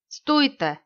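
loud, high order to halt; called-out command. A narrator's reading. Rider blocks the path of four horsemen, calling out. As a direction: call out to stop the riders. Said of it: "zvolal Old Shatterhand".

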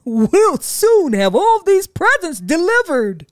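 cheerful and sure; jolly Santa. A narrator's reading. Santa flying off, jolly.

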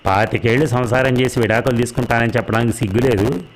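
usual speaking voice

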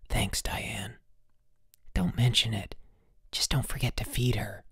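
soft, secretive whispered exchange; whispering. A narrator's reading. Bruno and girl part secretly at night, whispering. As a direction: keep it hushed so nobody wakes.

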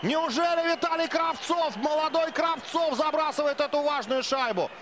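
excited goal call. Real, not acted. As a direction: ride the goal call at full pitch. Commentator erupts at a goal.

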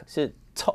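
错 said as the fricative of talk; 错 as talk's vowel. Shown as a demonstration. Teacher shows 错 as the talk sound. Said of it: "特变车了哈".

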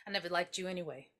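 very soft, low and quick; muted bitter aside. Retold or imitated. Retelling the shooter's words to his friend, quiet bitter aside. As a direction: drop it under your breath.

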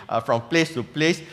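ordinary talk

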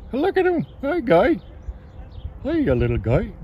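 squeaky high coo at a cute animal. Real, not acted. Talking to a little sea otter, high cooing baby voice. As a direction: coo up high like greeting a pet.

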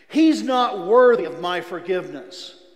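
loud defiant shout at God; a bitter shout of refusal at God. Retold or imitated. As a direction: yell it up to heaven in bitter refusal. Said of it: "one day I shouted out, out loud".

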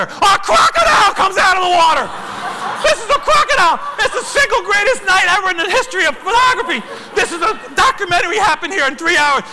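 hammed-up euphoric yelling; ecstatic shout. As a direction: top every earlier shout with astonishment. Climax: crocodile appears.

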